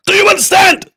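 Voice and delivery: sudden high shouted question; mock shout. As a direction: bellow it like a drill sergeant. Streamer demands chat understand.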